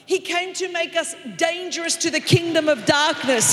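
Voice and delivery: loud, rising sermon shout; full-force preaching. Acted. Preacher to congregation, loud preaching shout. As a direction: preach it out to the whole church.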